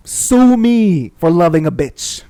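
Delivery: loud, slow, defiant declaration. Real, not acted. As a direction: throw it out like a challenge.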